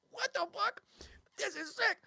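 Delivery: high-pitched amazed exclamation; high, astonished voice; awed reaction. Acted. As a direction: squeak it out in disbelief. Player's character reacting in awe.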